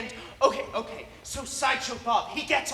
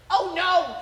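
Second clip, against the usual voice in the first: louder, higher mock-alarmed cry; cartoon alarm; 6 semitones higher, 8 dB louder than usual. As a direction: exclaim it like a cornered cartoon character. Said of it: "and he says".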